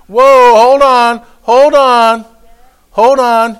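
forceful repeated call to halt; out loud. Acted. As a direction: order your own thoughts to stop. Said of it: "say it out loud".

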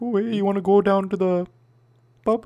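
high, loud mock Canadian; mocking Minnesotans by inviting a buddy to the pub in a Canadian accent. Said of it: "stereotypically Canadian".

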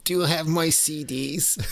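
high, goofy prank-caller voice; squeaky crank-call bit. Pretend. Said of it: "the Jerky Boys do music".

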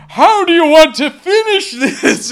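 high, loud put-on character voice; loud mock-dramatic challenge; hammed-up urging. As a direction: imitate a frantic demand at full pitch. Voicing the creature urging the girl.

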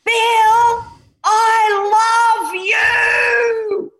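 put-on high, loud, drawn-out child's scream; screamed declaration. Mother retelling her daughter screaming love at a stranger. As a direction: shout it across the supermarket, stretched long. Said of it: "she screamed this through the supermarket".

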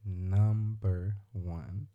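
Number one drawn out very slowly, each word stretched; slowly, spelling it out; slow emphatic repeat. Acted. To his friend, making his first point, dragged out slowly.

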